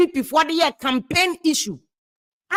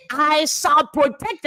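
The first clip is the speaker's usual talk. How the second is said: "shall" stretched slowly with a rise on "I"; stress on shall; much slower than usual. Shown as a demonstration. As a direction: say "shall" slowly as a binding promise. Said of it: "kyerɛ sɛ by force".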